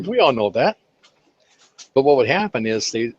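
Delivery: normal talking voice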